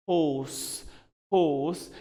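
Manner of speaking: British HORSE with silent r; British-accented HORSE, long aw; British pronunciation. Shown as a demonstration. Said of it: "if it is British".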